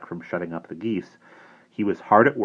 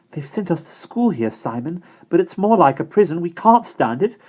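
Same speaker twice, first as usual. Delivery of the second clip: put-on higher, louder girl pleading her case; urgent girl's voice; 10 semitones higher, 7 dB louder than usual. Bonnie telling Simon the school is a prison, urgent. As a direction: tell him quickly how bad it is, raised and young.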